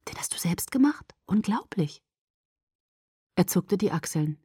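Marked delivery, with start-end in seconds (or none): 0.1-2.0 hushed amazement. Acted. Bella marvels at Jacob's handmade gift, half whispering. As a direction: marvel at it softly, almost whispering.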